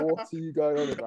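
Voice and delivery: retold disbelief through laughter; laughing mock bafflement; laughing retelling. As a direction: crack up while quoting the host. Retold host reply, teller cracking up.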